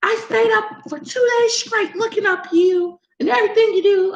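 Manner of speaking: high, shrieking accusing voice; hammed-up angry commenter threatening to report; outraged viewer lady. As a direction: rant like an obsessed angry viewer. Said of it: "she was like".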